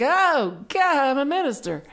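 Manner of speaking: high, loud mock panic; retold jolt of dread, pitch shooting up; terrified. New minister suddenly realizing the job, terrified. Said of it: "I got real terrified".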